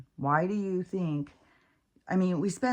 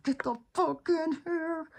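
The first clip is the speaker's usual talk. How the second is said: high yelled command; yelling at the dog; 12 semitones higher than usual. Retold or imitated. Yelling at Max who keeps running off, high and frustrated. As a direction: yell it at the dog who won't come. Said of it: "and I'm like".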